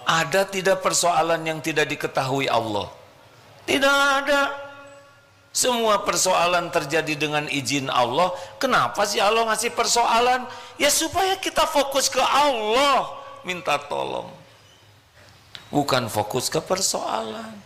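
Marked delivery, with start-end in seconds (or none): 3.7-4.5 high, loud emphatic answer. Acted. Preacher answering the crowd, high and emphatic. 10.8-13.1 high, ringing stress on "supaya"; preaching emphasis. Acted. Preacher driving home his point, high.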